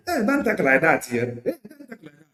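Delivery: ape a defensive supporter hushing critics; mocking scold. Mimicking supporters hushing a critic, high mocking scold. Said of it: "Onye kwuo ya na".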